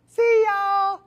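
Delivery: put-on high, loud cheery kid; very high, loud little boy calling goodbye. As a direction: chirp it like a thrilled five-year-old.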